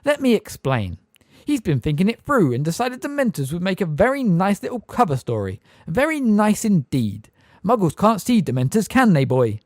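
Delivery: high, loud, sneering; sneering minister mocking the boy; smirking sarcasm. A narrator's reading. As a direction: lay the mockery on thick. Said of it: "still smirking".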